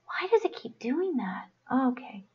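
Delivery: whispered under her breath, exasperated; frustrated whisper. Real, not acted. Whispering to herself as her stream's comments vanish. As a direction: mutter it to yourself, fed up.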